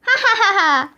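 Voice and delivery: hammed-up ha-ha at own pun; fake laugh. Principal laughing at his own pun, hammed-up ha-ha. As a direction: chuckle at your own bad joke.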